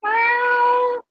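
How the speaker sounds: high playful cat meows; meowing. Pretend. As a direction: meow like a kitten. Meowing back and forth.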